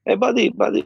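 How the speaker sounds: high-pitched imitation of a Persian club owner's accent; Jamie Masada Persian accent. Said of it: "by the way, he's Persian".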